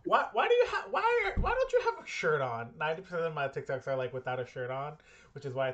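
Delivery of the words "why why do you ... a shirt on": high, mock-baffled questioning; imitating a puzzled friend. Friend asking why he's flexing shirtless, high-pitched mock. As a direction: whine the question like a confused buddy. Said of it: "my boy's gonna look at this later and be like".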